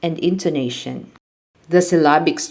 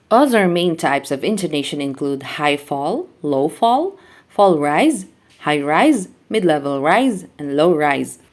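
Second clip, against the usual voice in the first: ordinary talk, nothing marked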